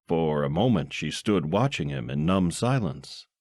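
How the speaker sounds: ordinary delivery, nothing marked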